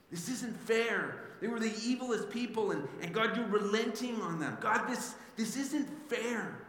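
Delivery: mock outrage at God; livid complaint. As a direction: whine it like a wronged prophet. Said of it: "He was livid".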